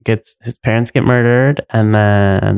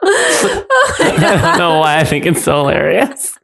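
high, slow words through laughter; real giggling, pitch climbing; helpless laughing; 8 semitones higher, slower than usual. Real, not acted. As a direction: talk while cracking up. Laughing at own impression.

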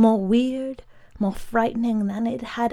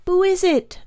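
put-on high, louder boy's cry of alarm; alarmed high-pitched question; frightened child's cry; 10 semitones higher, 5 dB louder than usual. A narrator's reading. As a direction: cry out into the dark as a scared child. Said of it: "the boy cried".

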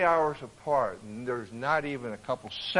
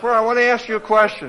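higher, louder acted address; acted questioning; 8 semitones higher, 10 dB louder, faster than usual. Peter confronting the wife with a question, raised voice. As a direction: put the question loudly, in mock earnest.

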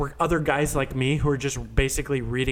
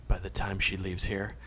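hushed, low near-whisper; sinister aside; 7 semitones lower, 7 dB quieter than usual. Retold or imitated. Trump confiding to his brother after she walks out, sinister whisper. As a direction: murmur a cold threat under your breath. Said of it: "Donald Trump turns to the brother and goes".

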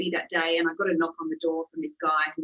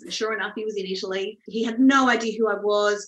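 ordinary delivery

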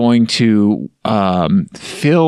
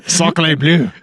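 bad French accent; 4 semitones higher than usual. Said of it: "it's pretty terrible".

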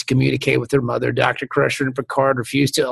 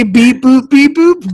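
higher, louder mock beeps; robot beeps; 9 semitones higher, 7 dB louder, slower than usual. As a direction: beep it like a sing-song computer. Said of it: "you would say".

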